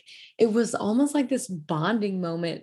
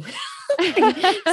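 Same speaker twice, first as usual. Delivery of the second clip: laugh; 13 semitones higher, 5 dB louder than usual. Real, not acted. Laughing at start of chat.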